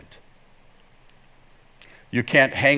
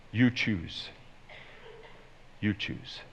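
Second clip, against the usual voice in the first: humble surrender; 3 semitones lower, 11 dB quieter, slower than usual. Acted. David submits to God's judgment.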